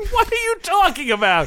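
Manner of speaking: high, squeaky laugh into a question; laughing disbelief. Real, not acted. Laughing disbelief at game lore.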